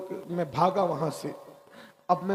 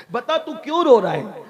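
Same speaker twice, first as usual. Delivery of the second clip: louder, higher pressing question; insistent demand; 5 semitones higher, 8 dB louder than usual. Acted. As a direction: press for the answer with raised voice. (ज़ोर देकर ऊँची आवाज़ में पूछना)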